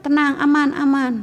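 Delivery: high-pitched mock child placating mom; putting mom off. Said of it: "kita kalau dipanggil ya". (menenangkan ibu, meniru anak yang menunda)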